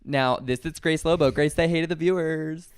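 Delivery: ordinary delivery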